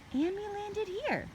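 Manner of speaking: put-on high, slow little girl voice; Annie's voice. A narrator's reading. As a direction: lift it up into a young girl. Annie voiced higher in story.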